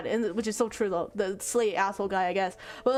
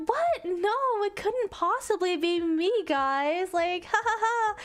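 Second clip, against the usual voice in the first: high, put-on girly fluster; coy denial; 9 semitones higher, slower than usual. Popular girl denying it to the girls, coy and high. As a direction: fake modest protest with a tittering laugh.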